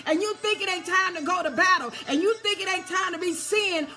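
high, driving sermon delivery; full-force preaching. Real, not acted. Preacher warning people to stay on alert, full-force preaching. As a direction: build to loud, urgent exhortation.

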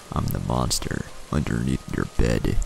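low, quiet monster voice; deep crackly monster. A narrator's reading. Monster introduces itself to the child, deep and crackly. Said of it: "deep and crackly".

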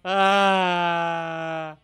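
usual speaking voice

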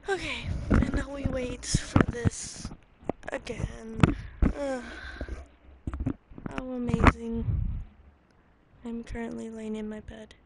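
loud, clipping cheap mic sound; distorted through a bad USB mic. Real, not acted. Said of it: "Sorry for the bad mic".

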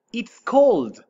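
loud, bright teacher demo; loud model. Shown as a demonstration. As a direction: call out It's cold high and loud. Teacher models cold two ways.

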